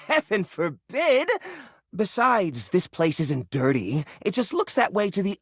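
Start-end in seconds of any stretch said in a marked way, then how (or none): 0.0-1.3 appalled chuckle; laughing it off in mock horror; laughing scoff.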